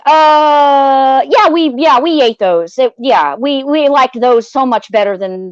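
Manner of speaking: mock stammered fib, stretching the opening sound; hesitant covering up. Adega assures Loki they ate the fruit, imitated stalling.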